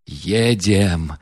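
usual speaking voice

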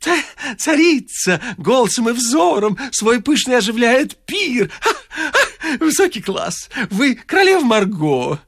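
high, fluttering compliments; gushing flatterer. A narrator's reading. The wit raving over the suburban girl, high and gushing. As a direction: pour on the flattery, breathless with delight.

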